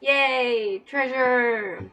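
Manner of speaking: ordinary delivery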